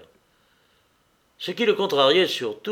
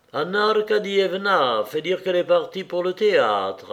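higher-pitched announcement from a servant; servant announcing; 6 semitones higher, 4 dB louder than usual. A narrator's reading. Servant bringing word of Anna's departure, announcing. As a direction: announce it formally to the master. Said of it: "annonça un domestique".

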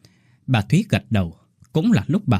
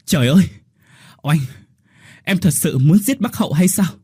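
shocked shout; 6 semitones higher than usual. A narrator's reading. Nhat Ha running in, shouting at Oanh in shock. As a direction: burst in shouting in horror. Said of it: "đã hét lên".

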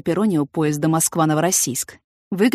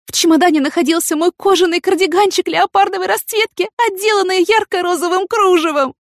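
high-pitched, loud, theatrical despair; wailing heroine; 12 semitones higher, 5 dB louder than usual. A narrator's reading. Yana mourning her favourite leopard cardigan, distraught. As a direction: lament the loss in a high plaintive voice.

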